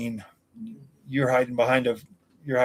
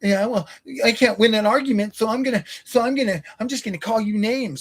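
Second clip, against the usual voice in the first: mocking whiny loser; 7 semitones higher than usual. Streamer mimicking his critics in chat, whiny higher mock voice. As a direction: sneer at them by playing their whine. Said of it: "You losers just straight".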